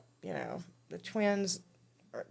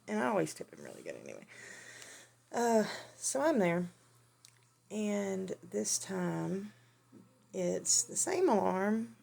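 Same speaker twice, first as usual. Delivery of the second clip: ordinary delivery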